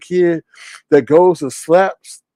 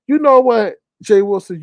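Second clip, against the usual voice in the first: acted scolding; 7 semitones higher than usual. Acted scolding of Jay Wilson.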